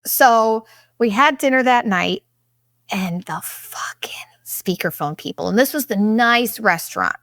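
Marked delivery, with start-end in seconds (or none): 2.9-5.5 hushed, breathy aside; whisper. Real, not acted. Complaining about the speakerphone couple, whispered. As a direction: whisper it so they can't hear. Griping about loud diners.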